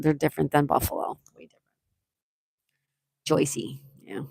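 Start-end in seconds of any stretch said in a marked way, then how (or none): 3.3-3.8 soft mock New Jersey accent. Shown as a demonstration. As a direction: quietly mimic a Jersey local. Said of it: "the Jersey accent".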